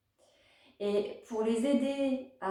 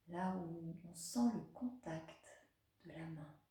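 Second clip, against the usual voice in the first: guided meditation; 4 dB quieter than usual. Acted. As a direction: guide a child gently through a body scan, unhurried.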